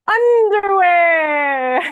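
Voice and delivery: long, high, drawn-out shout; loud singsong holler, stretched right out; kids' gleeful yell. Retold or imitated. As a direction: imitate a crowd of kids yelling the answer. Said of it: "And they go".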